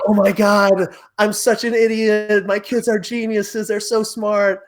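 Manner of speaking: high-pitched imitation of ugly crying; mock tearful. Dad reacting to his kid over scrambled eggs, mock tearful. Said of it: "ugly face crying".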